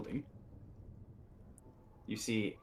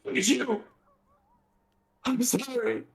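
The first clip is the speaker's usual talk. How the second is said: cursed son voice; 6 dB louder, slower than usual. Acted.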